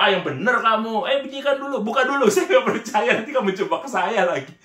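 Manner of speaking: loud, high-pitched incredulous challenge; acted disbelief. Older brother doubting the car is his friend's, playful disbelief.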